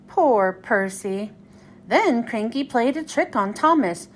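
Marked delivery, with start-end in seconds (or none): none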